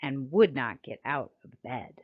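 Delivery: lively, sing-song storybook reading; reading to children. A narrator's reading. Narrator reading a rhyming picture book to children, lively and sing-song. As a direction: read it like bedtime rhyme time for kids.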